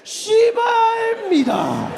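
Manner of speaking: polite Korean curse. Retold or imitated. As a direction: yell it but keep it formal. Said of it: "they're like".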